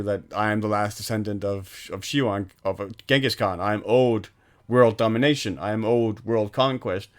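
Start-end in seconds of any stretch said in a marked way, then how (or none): none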